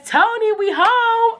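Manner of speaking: sing-song mom call. Retold or imitated. Mom arriving home.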